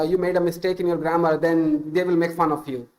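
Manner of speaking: ordinary delivery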